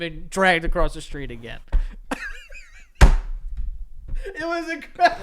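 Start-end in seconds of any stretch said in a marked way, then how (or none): 1.6-4.3 a wheezy, silent high-pitched laugh; breathless high laugh. Real, not acted. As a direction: laugh so hard no voice comes out. Laughing at dad's fall.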